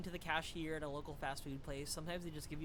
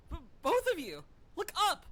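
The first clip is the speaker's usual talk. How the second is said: loud high call; 9 semitones higher, 11 dB louder than usual. Acted. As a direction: shout to get them looking. Calling companions.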